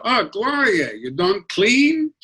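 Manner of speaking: high, drawn-out grandmother's nag; disapproving grandmother. Retold or imitated. Grandmother inspecting the fireplace, nagging in a high accented voice. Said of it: "she'd go like this".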